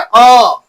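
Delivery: अ held long from mid-tongue; central vowel. Shown as a demonstration. Teacher showing the middle vowel, held अ. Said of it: "जीभ का मध्य भाग होता है".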